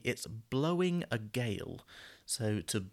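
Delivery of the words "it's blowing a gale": slow and clear, each word laid out; slow model phrase. Shown as a demonstration. Teacher to students, modelling the phrase slowly. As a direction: set the phrase down slowly for learners. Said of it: "sometimes we will say".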